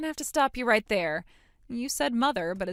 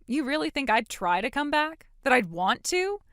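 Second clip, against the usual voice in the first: louder, higher scornful challenge; indignant disbelief; 4 semitones higher, 5 dB louder than usual. Acted. Daughter challenging mother about coming back, indignant disbelief. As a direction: throw it back, incredulous.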